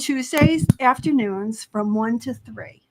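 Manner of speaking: slow, deliberate repeat of the schedule; unhurried, so listeners can catch it. Real, not acted. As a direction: spell out the day and hours slowly. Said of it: "you said it kind of fast".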